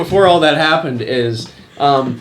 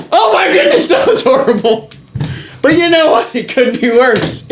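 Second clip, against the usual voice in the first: excited; 9 semitones higher, 4 dB louder than usual. Real, not acted. Reacting to own bad throw.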